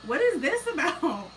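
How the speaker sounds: a hint of a bewildered little boy; mock puzzled boy. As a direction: play the confused kid, laughing. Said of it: "And he's like".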